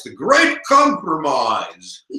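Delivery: slow, drawn-out mock-grand title; grand announcement. Teacher presenting the first compromise, mock-grand. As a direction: stretch the title out with pomp.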